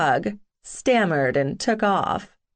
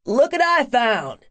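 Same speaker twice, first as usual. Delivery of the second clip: loud, higher excited shout; 6 semitones higher, 3 dB louder than usual. Acted. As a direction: call it out across the yard. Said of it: "Tug shouted".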